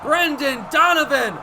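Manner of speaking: loud, high play-by-play call; excited home run call. Acted. Radio announcer calling the go-ahead hit. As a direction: ride the play up in a shout.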